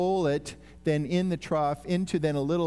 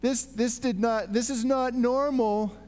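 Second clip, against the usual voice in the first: higher-pitched, stumbling disbelief; acted astonishment; 5 semitones higher than usual. Witness facing the empty grave cloths, stunned disbelief.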